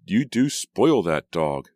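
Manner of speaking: drawn-out, playful grumbling; good-natured grumble. A narrator's reading. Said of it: "Julia grumbled good-naturedly".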